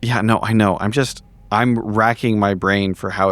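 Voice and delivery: usual speaking voice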